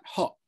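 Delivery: "hot" ending in a catch instead of T; glottal stop. Shown as a demonstration. Teacher isolating the sound, "hot" with a glottal stop. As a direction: choke off the end of "hot" in the throat. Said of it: "that little stop at the end there".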